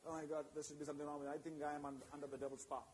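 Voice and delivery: hushed, quick worried murmur to oneself; a believer's frightened private thought, fast and hushed; anxious inner voice. Retold or imitated.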